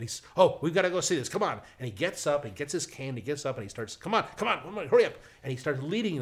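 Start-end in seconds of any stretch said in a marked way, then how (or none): 0.4-1.6 eager uncle, higher and louder; rally the family to get moving; excited urging. Retold or imitated. Uncle rushing family to the TV, excited. 4.0-5.1 higher, louder hurrying-along; impatient urging. Retold or imitated. Uncle leading nephew and mother out, excited urging.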